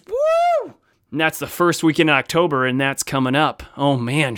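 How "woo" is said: high excited whoop. Real, not acted. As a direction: whoop with joy. Excited about going to Shucks.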